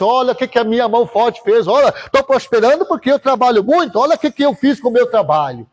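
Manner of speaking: high-pitched, rushing self-praise; mock boasting king. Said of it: "começou a achar que era ele".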